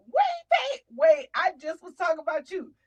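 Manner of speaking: high, loud sudden "wait, wait"; excited interruption. Real, not acted. Storyteller spotting her friend, loud excited call. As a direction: stop everything and call out to a friend.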